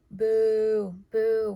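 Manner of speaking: loud, drawn-out mock jeer; loud pantomime boo; playful booing. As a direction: boo them like a heckler, in fun.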